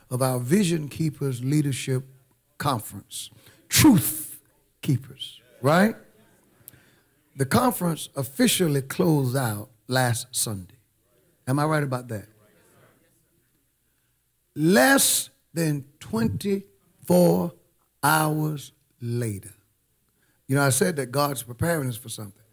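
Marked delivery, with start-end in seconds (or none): 3.7-4.4 Truth punched high and loud; stress on Truth. Real, not acted. Corrects the conference name with emphasis. 14.6-19.5 very slow, word by word; measured, weighty countdown; dramatic slow build. Real, not acted. Builds suspense before the news.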